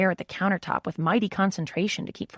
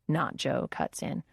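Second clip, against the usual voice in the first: usual speaking voice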